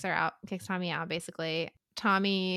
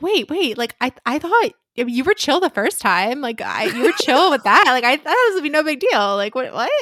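louder, higher flustered backpedal; flustered defensive pleading; 3 semitones higher, 6 dB louder than usual. Retold or imitated. Tommy caught out, pleading with Philippa, louder and flustered. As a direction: talk fast to smooth it over. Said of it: "And Tommy's like".

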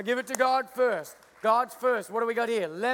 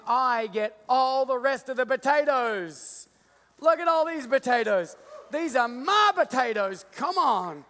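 greedy glee; 3 semitones higher, 5 dB louder, slower than usual. Pretend. Farmer gloats to the congregation over his potatoes, shouting. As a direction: crow over your own haul.

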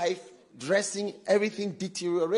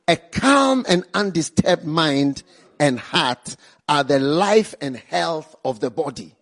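preached scripture reading; 9 dB louder than usual. Shown as a demonstration. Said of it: "The Amplified one says".

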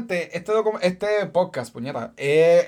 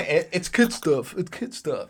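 mock casual dismissal; 4 dB quieter, slower than usual. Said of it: "le dice".

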